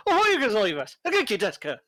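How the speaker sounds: Japanese in a high, cheerful Irish lilt; upbeat Irish accent. Retold or imitated. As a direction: greet them in chirpy, loud Irish-accented Japanese. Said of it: "Irish is always sounds upbeat and cheerful".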